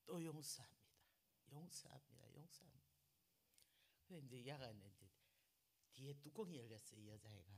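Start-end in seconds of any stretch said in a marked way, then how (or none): 0.1-2.8 each repeat softer, fading away; fading prayer. Retold or imitated. Praying to God, forgiving again, fading. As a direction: let the words die to a murmur.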